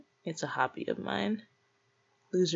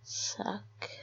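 whispered, soft order Suck; whispered command; 11 dB quieter than usual. Acted. Ordering the listener to suck in a soft whisper.

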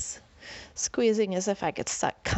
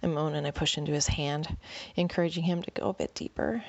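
plain reading voice

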